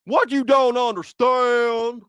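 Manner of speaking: high, slow mock Southern drawl; ex-father-in-law's Virginia accent. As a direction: explain it in a hammed-up Virginia twang. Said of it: "he used to talk like that".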